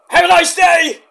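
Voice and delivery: high, loud, badly done impression; Mick. Shown as a demonstration. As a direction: blurt it in a squeaky put-on voice. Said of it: "really bad impression".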